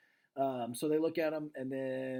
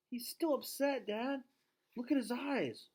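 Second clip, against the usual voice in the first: put-on much higher young daughter insisting; girl's voice; 10 semitones higher than usual. Julia urges her dad to look, high girl voice. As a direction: pitch up into a girl pleading.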